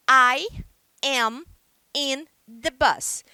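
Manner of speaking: I am said slowly, word by word, with I and am apart; slow careful full form, I am in the bus; unhurried full form. Shown as a demonstration. Teacher modeling the uncontracted form to students, slow and clear. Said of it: "Ou eu posso falar mais rápido".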